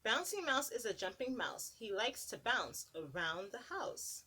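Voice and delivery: put-on lilting read-aloud to little kids; sing-song picture book narration; storybook reading. Reading a picture book to children, lilting.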